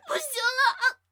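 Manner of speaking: very high, very loud crying screams; anguished screams. Acted. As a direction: scream in agony. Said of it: "索菲亚的惨叫".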